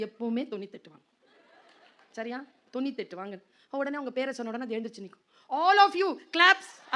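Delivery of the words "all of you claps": high loud classroom call in English; mock teacher command. Teacher ordering the class to applaud, loud high call.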